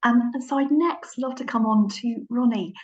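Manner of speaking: usual speaking voice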